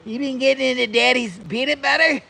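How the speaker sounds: high playful scolding for the dog; teasing the dog. Real, not acted. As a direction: tease the fat dog playfully.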